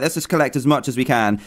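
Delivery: ordinary talk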